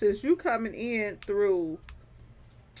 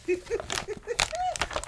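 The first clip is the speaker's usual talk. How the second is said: breathy laugh. Real, not acted. Laughing fit.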